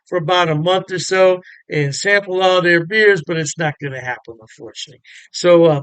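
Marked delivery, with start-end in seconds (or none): none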